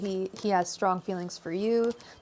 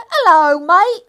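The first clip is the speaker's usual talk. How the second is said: high, loud hammed-up Aussie greeting; bad Australian accent; 10 semitones higher, 16 dB louder, slower than usual. Tarot reader greets an Aussie viewer in mock Australian accent. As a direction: overdo a cheesy Australian hello. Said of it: "That was awful".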